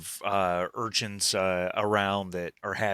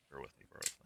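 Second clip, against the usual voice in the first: muttered aside; 6 semitones lower, 16 dB quieter, much faster than usual. Real, not acted. GM mutters while loading picture.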